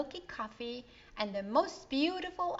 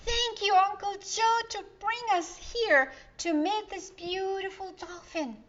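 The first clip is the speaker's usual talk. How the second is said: put-on much higher, louder girl voice; Donna thanking; 10 semitones higher, 6 dB louder than usual. A narrator's reading. Donna thanking her uncle, high and bright. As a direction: gush your thanks. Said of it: "Donna chimed in".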